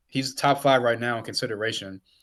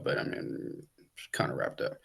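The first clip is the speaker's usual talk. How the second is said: a sly hushed admission, lowered way down; whispered aside; 11 semitones lower, 9 dB quieter than usual. Real, not acted. Podcast host conceding the award race to co-host, whispered. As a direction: whisper it like a secret everyone knows.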